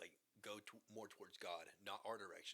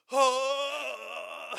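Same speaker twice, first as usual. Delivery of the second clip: very loud high-pitched scream into laughter; scream through regulator; 20 dB louder than usual. Retold or imitated. Surfacing with the ring, loud joyful scream. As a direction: scream with all your joy. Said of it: "screaming through my regulator".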